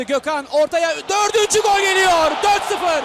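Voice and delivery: loud, rising goal call; excited goal shout. Real, not acted. Fourth goal called out. (heyecanlı gol bağırışı)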